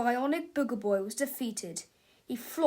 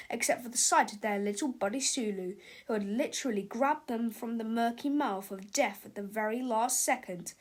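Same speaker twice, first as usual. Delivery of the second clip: ordinary delivery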